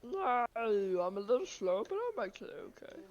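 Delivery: loud, strained, throat-scraping voice; grating voice. Pretend. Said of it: "I'm gonna stop talking in that voice. That really hurts my throat".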